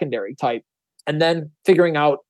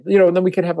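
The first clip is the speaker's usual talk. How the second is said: normal talking voice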